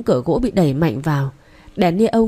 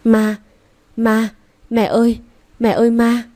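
higher-pitched, restrained scream of fright; terrified cry; 3 semitones higher than usual. A narrator's reading. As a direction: cry out to mother about a ghost. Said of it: "mà hét lên".

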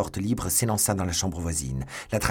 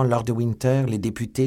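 ordinary delivery

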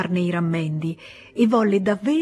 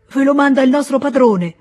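loud, higher proclamation; a rough servant proclaims the delivery, loud and high; proclaiming loudly; 6 semitones higher, 8 dB louder than usual. Acted. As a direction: announce it boldly like a rustic messenger. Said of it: "proclamò lo zotico".

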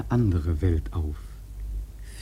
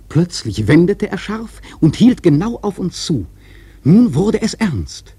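louder and higher, the danger mounting; rising tension; 8 semitones higher, 6 dB louder than usual. A narrator's reading. Narrator telling listeners the whale charges, tense and louder. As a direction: build the suspense as the whale charges.

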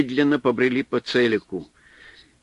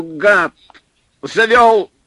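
much louder and higher enraged scream; furious yell; 9 semitones higher, 9 dB louder, much slower than usual. A narrator's reading. Supply man screaming at Pavel, enraged. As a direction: scream the insult full force.

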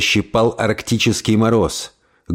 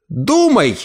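high clipped command; staccato; 7 semitones higher than usual. Acted. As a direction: bark it short. Aunt commanding her body.

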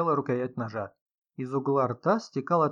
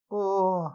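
drawn-out higher-pitched groan; moan; 6 semitones higher than usual. A narrator's reading. Writer recalls the body, moaning. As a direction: moan as if reliving the horror. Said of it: "застонала писательница".

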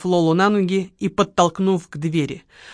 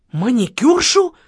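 raised, drawn-out baffled question; surprised echo; 5 semitones higher, 4 dB louder, slower than usual. A narrator's reading. Marquis hears Lola's accusation, astonished. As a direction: echo the word slowly in astonishment. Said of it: "удивлённо переспросил маркиз".

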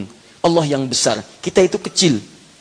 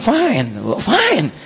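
put-on English accent; 2 semitones higher, much slower than usual. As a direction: imitate an Englishman's small talk. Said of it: "Kayak orang Inggris". (logat Inggris tiruan)

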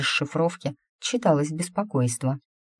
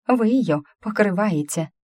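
angry woman; 5 semitones higher than usual. Acted. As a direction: burst out at the witch. Angry woman in crowd accuses.

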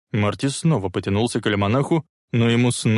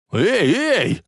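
sharp alarmed yell, high; panicked passenger yelling at the driver; 10 semitones higher, 4 dB louder than usual. A narrator's reading. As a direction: shout it out in alarm. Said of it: "заорал Биф-младший".

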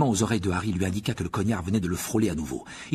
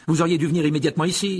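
loud, fast, raised scolding; fuming; 6 semitones higher, 7 dB louder, faster than usual. A narrator's reading. Madame Pomme Fraîche furious at the delay, fuming. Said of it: "fulmina-t-elle".

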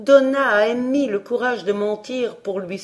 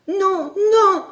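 much higher, louder frantic no; boy crying out; 11 semitones higher, 6 dB louder than usual. A narrator's reading. Emmy begging the stranger, much higher and louder. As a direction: shout it in panic. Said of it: "s'écria Emmy".

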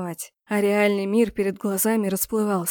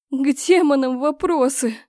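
offended outburst; 5 semitones higher, 5 dB louder than usual. A narrator's reading. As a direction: blurt it out, hurt and louder. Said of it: "обиженно выпалила в ответ". (обиженно выпалить, громче и выше)

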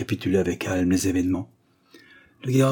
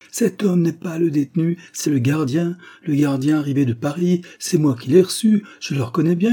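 higher, louder outraged exclamation; exclaiming; 7 semitones higher, 4 dB louder than usual. Acted. Guard's wife recognizes the corpse, exclaiming loudly. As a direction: cry out the discovery in shock. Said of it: "s'écria".

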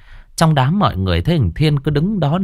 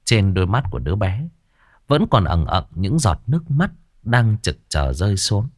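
ordinary delivery, nothing marked

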